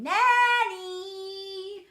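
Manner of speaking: long sing-out scream, high and loud; child screaming. A narrator's reading. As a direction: scream the name back, stretching the ending. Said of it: "And then I scream".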